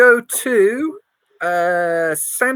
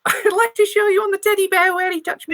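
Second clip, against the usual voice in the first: high squeaky mouse voice; 9 semitones higher than usual. Acted. Mouse telling the detective, high and squeaky. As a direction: pipe it up deadpan.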